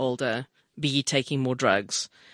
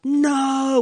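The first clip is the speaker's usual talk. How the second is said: high, drawn-out cry of disbelief; mock yell, stretched and pitched up; retold yell; 8 semitones higher, 4 dB louder than usual. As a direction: recreate a long yelled no, held high. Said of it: "I literally yelled out".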